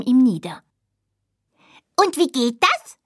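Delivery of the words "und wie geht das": high, bright curious small-animal voice; a tiny creature asking eagerly; little dormouse voice. Acted. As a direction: hazel mouse asking her bored friend. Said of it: "fragte sie".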